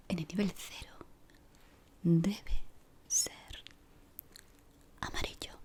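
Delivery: ordinary talk, nothing marked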